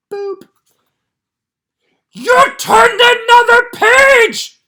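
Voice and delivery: high, loud, slow furry monster shouting; a panicked puppet monster begging the reader; Grover voice. Acted. Grover voice reading the book.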